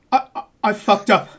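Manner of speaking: the man on the ledge breaking down; despairing jumper. Acted. Jumper on the railing confessing to the player, loud panicked despair. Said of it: "He goes".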